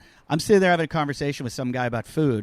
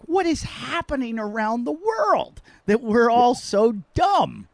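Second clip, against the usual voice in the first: much higher, slow, exasperated; exasperated disbelief; 7 semitones higher, slower than usual. Retold or imitated. Comedian lamenting the world, high and slow exasperation.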